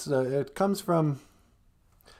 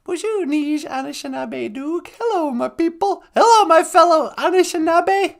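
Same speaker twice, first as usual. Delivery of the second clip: much higher puppet voice; high puppet greeting; 13 semitones higher, 5 dB louder than usual. Pretend. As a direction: greet your people as a squeaky puppet. Puppet copies the host's greeting.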